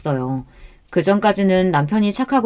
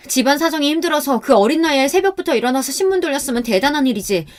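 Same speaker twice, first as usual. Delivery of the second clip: higher-pitched, scornful telling-off; acted outrage in a raised pitch; indignant rebuke; 6 semitones higher than usual. Wife tells off husband's mocking friends, indignant.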